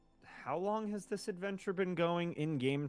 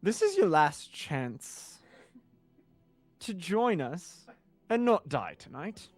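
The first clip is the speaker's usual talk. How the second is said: slow threat; 6 semitones higher, 14 dB louder, slower than usual. Acted. Threat before duel.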